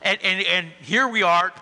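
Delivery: ordinary talk, nothing marked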